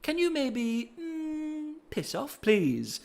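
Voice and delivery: high, soft, mock-polite father; polite grieving dad. Widowed father asking Vi to leave, mock polite. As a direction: ask him to leave with strained politeness. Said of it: "and he's like".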